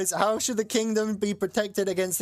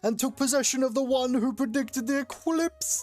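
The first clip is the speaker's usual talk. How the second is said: put-on higher, grand Scottish-accented priest; 6 semitones higher than usual. Priest warning the ruler of the eclipse, Scottish accent. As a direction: proclaim it like a Scottish monk. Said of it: "they're all Scottish".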